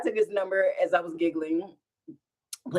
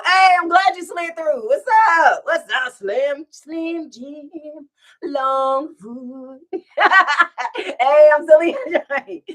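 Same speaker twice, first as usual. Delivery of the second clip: excited greeting; 7 semitones higher, 8 dB louder, much slower than usual. Real, not acted. Greeting a viewer by name.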